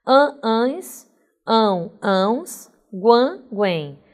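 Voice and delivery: "An, ans, ão, ãos, guan, guem" as separate nasal endings; nasal sounds. Shown as a demonstration. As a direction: sound out each nasal ending in contrasting pairs. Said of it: "você tem os sons nasais". (terminações nasais pronunciadas uma a uma, aos pares)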